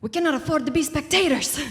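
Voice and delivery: imitating a Colombian speaker's accent, with affection. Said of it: "hard to not say it in his accent".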